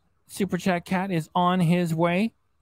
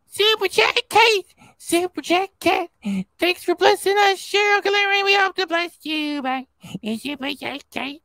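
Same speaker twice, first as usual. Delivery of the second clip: Super Chat Cat; 7 semitones higher than usual. Acted. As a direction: announce yourself as a cartoon cat, way up high. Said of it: "Hit it, Super Chat Cat".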